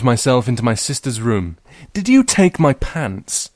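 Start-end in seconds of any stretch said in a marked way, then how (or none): 1.9-3.5 high slow accusation; accusing. Acted. Girl accuses her sister, high and slow. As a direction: demand it from your sister.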